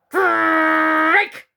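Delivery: loud bark-like yelp. Acted. Dana the dog barking in alarm, loud yelp. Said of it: "barked Dana".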